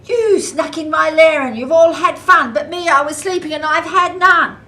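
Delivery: loud high wailing bear complaint; angry bear. Acted. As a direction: bellow like a grumpy bear.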